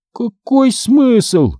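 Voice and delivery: very high, slow protest; arguing zombie son. A narrator's reading. Son argues back at parents, high slow zombie drawl.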